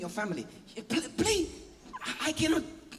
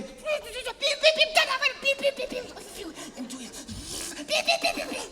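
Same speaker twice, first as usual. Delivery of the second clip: high, rapid beeps; imitated beeping; 13 semitones higher than usual. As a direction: play a nagging alarm. Imitating the car horn and alarm.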